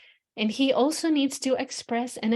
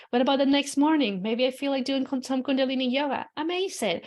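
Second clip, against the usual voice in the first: ordinary talk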